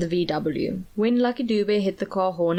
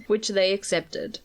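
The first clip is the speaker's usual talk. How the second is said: ordinary delivery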